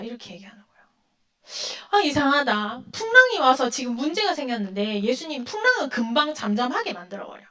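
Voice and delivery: normal talking voice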